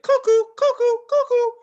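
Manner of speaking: very high, loud cartoon bird calls; cartoon cuckoo. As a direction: squeal it like birds circling a dazed head. Imitating cartoon birds after head bump.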